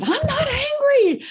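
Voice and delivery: high, loud mock-indignant denial of being angry; hammed-up retold protest, voice jumping way up. Recalling snapping back at her friend at the top of the stairs, high, loud mock denial. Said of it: "Sounds like the response of an angry person".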